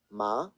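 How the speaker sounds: "ma" rising like a question; second tone. Shown as a demonstration. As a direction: start "ma" mid and lift it. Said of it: "the second tone that we see here".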